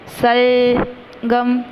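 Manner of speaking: सलगम said very slowly, syllable by syllable; slow clear word. Shown as a demonstration. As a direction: stretch out सलगम carefully. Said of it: "तो यहाँ पे लिखेंगे". (सलगम को धीरे-धीरे साफ़ बोलिए)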